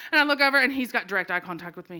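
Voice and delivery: ordinary talk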